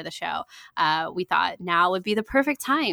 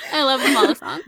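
high giggling through words; laughing. Real, not acted. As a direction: laugh while saying it. Giggling together.

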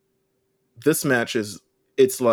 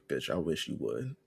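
mock threat; 6 semitones lower, 11 dB quieter than usual. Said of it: "she was like".

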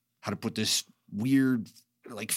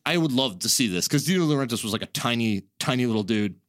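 usual speaking voice

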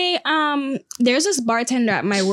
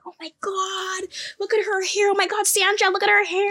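very high, gushing mock voice; 9 semitones higher than usual. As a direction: coo in exaggerated amazement, drawing out the first words.

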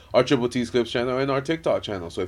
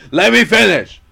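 louder, higher insistence; insisting loudly; 11 semitones higher, 14 dB louder than usual. Real, not acted. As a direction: demand the floor loudly. Loud insistence, interrupted.

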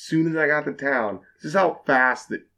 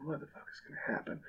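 quick, voiceless, much softer whisper; whispered dread; 4 semitones higher, 21 dB quieter, faster than usual. Retold or imitated. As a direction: peek over your shoulder, whispering.

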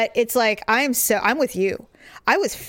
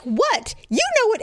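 defensive snap; 12 semitones higher than usual. Retold or imitated. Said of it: "And I'm like".

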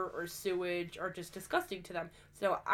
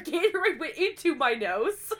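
ordinary delivery

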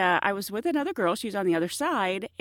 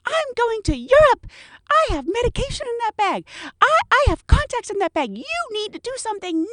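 very high, loud frantic complaint; hysterical passenger; 14 semitones higher, 5 dB louder than usual. Retold or imitated. Passenger demands help with her bag, shrill high outrage. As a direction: shriek the grievances. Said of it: "She's like".

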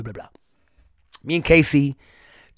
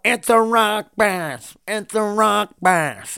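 slow, drawn-out, higher mock yell from another boat; imitating a funny yell; 6 semitones higher, much slower than usual. Retelling a stranger yelling from a nearby fishing boat, slow mock yell. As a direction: stretch each word like a stranger hollering across the water. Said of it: "the way they said it was fucking hilarious".

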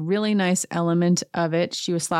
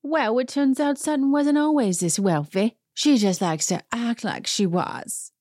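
put-on Dorit's accent; 5 semitones higher than usual. Host doing Dorit's accent for her dig at Sutton. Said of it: "let me do her accent".

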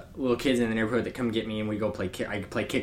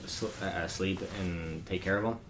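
normal talking voice

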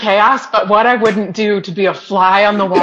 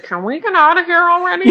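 impatient whine; 8 semitones higher, faster than usual. Retold or imitated. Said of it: "she's like".

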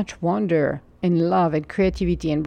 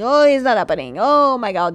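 louder, higher, mock panicked yell; panicked inner yelling; 5 semitones higher, 5 dB louder than usual. Speaker imitates the inner voice that yells in panic, louder and higher. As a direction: play the frantic voice in your head, half yelling. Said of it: "you hear screaming and yelling".

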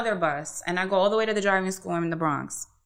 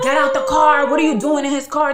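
higher and louder, mock furious boyfriend; jealous boyfriend yelling at the coworker, imitated; retold jealous yell; 6 semitones higher, 8 dB louder than usual. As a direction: shout it like the ex making a scene. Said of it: "He made a scene with the coworker".

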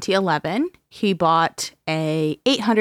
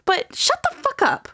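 exasperated outburst; 12 semitones higher than usual. Real, not acted. Venting to her co-host about excuses, exasperated high outburst. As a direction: pitch jumps way up in exasperation.

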